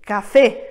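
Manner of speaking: café said in Spanish, stress landing on fé; café with the accent on the last syllable. Shown as a demonstration. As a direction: hit the final fé in café. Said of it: "accent écrit qui nous signalera où porte l'accent tonique".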